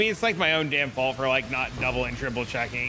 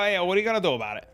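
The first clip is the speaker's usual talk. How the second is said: quick, higher, louder New Jersey mobster shrug; Tony Soprano; 5 semitones higher, 4 dB louder, much faster than usual. Pretend. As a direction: fire off "what are you gonna do" like a Jersey wiseguy. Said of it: "Tony Soprano?".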